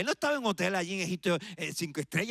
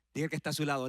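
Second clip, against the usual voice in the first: ordinary delivery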